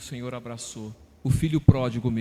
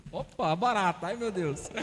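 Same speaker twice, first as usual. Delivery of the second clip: real startled aside, pitched higher; 4 semitones higher than usual. Real, not acted. Speaker spots a cockroach mid-answer, startled and amused. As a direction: jump at a sudden bug.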